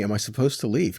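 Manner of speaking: ordinary talk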